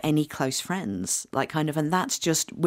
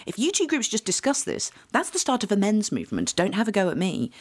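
exasperated retort; 7 semitones higher than usual. Retold or imitated. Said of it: "I was like".

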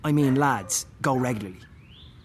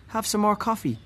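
Mum's voice; 9 semitones higher than usual. Retold or imitated. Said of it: "said Mum".

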